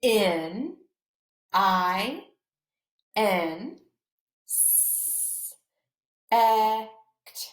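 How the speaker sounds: each letter said slowly with pauses; spelled letter by letter. Shown as a demonstration. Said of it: "Let's sound it out".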